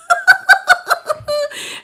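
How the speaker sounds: a high, real burst of laughter. Real, not acted. Reader cracking up at chat's hints, high laugh. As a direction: break into giggles high up.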